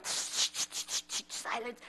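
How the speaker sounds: breathy shushing, then a near-silent "silence"; hushing whisper. Retold or imitated. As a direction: hush them like a strict church usher. Church attendant hushing tourists.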